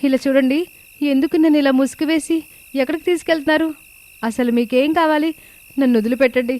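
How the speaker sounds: put-on high, pleading voice of a scared young woman; frightened pleading. A narrator's reading. Captive daughter-in-law begging the two thugs, high frightened plea. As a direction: beg the kidnappers to let her go. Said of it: "అంటూ వేడుకుంటూ ఉంటుంది".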